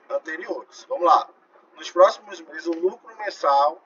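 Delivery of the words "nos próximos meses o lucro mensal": slow, raised dictation with stretched words; emphatic dictation. Real, not acted. Teacher writing out the profit rule for students, slow emphatic dictation. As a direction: dictate it firmly, phrase by phrase. Restates the question for the class.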